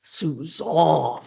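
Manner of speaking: "Suzanne" as a drawn-out soo-ZAHN; corrected pronunciation. Retold or imitated. President finally gets her name right, "Suzanne" drawn out as soo-ZAHN. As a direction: stretch the open "ah" ending of "Suzanne". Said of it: "he looked at me and he said".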